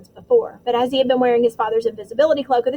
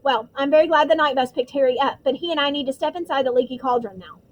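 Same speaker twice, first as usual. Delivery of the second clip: plain reading voice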